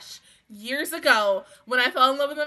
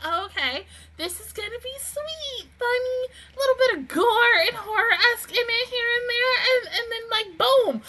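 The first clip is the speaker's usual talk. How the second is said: high-pitched mock-innocent musing; naively pleased; 10 semitones higher than usual. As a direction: act out your own innocent expectations, pitched way up. Acting out own naive first expectation.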